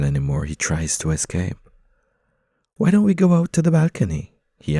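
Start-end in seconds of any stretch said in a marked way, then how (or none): 2.8-4.3 eager suggestion. Acted. He proposes escape, lively.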